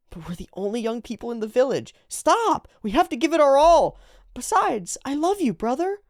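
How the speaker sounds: put-on very high, insistent girlish role voice; pleading sister character.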